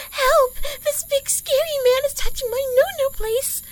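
high-pitched helpless-victim act; mock cry for help. Woman showing her attacker how she'd call for help, mock scream. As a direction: yell out in a put-on, panicked high voice. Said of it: "yells out".

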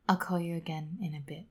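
quick soft whisper. Real, not acted. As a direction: whisper a fond goodbye.

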